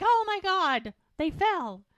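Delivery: high, loud mock exclamation; shocked onlookers. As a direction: cry out like an astonished crowd.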